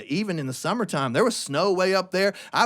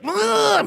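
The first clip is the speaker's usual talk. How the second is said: high, pushed grunt; mock grunt; grunting glare; 13 semitones higher, 4 dB louder than usual. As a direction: make an ugly-face grunt. Glaring at the turkey.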